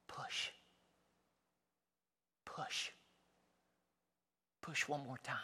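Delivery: soft breathy whisper, push; whispering right in your ear. Acted. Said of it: "that's just me whispering in your ear".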